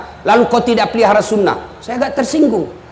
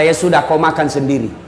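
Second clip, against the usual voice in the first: much lower, chuckling indulgent voice; amused Prophet; 9 semitones lower, 4 dB quieter than usual. Retold or imitated. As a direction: give in with a laugh, low and kind. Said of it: "Nabi lalu berkata".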